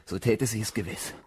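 ordinary talk, nothing marked

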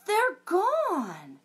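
a high worried wail; dismayed mama. Acted. Mama discovering the carrots gone, high and upset.

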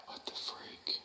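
whispering. Real, not acted. Reacting to scary noise.

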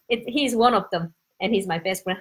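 usual speaking voice

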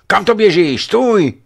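very high, loud yell; alarmed shout. A narrator's reading. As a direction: yell to stop a runaway child. Shouting at a running boy. (prudký vysoký výkřik)

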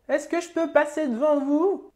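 put-on very high, slow old-woman voice; drawn-out request from an old dear; old lady. Old woman asking to jump the queue, old-lady voice. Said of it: "l'ancêtre".

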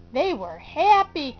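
plain reading voice

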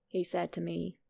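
ordinary delivery

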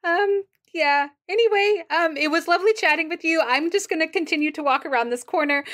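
high, awkwardly polite brush-off; awkward politeness. Acted. Jenna fleeing an awkward chat round the corner, awkwardly polite. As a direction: excuse yourself in a high, over-polite voice.

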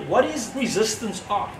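usual speaking voice

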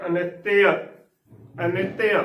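ਅਨਿਤਯ said a-ni-te-a, with an i on the t; demonstrated pronunciation. Shown as a demonstration. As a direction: sound ਅਨਿਤਯ with the y opened into a vowel. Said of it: "ਇਸ ਨੂੰ ਬੋਲਣਾ ਹੈ".

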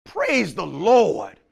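drawn-out praise to the Lord; a preacher's slow exclamation of praise. Acted. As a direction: stretch it out in worship.

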